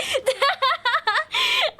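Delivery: high bright burst of laughter; high giggle. Real, not acted. Streamer laughing at the love letter. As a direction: squeal with laughter.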